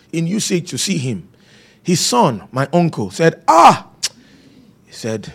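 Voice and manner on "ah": high sharp gasp of shock; retold startled "Ah"; mock cry of alarm.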